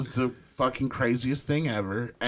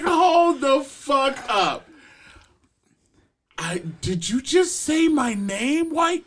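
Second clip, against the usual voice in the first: disbelief; 12 semitones higher, 4 dB louder than usual. Retold or imitated. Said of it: "I was like".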